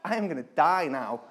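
ordinary talk

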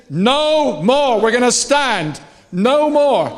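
rally the room with force; defiant rallying cry. Real, not acted. Preacher urging the congregation to stand, defiant rallying cry.

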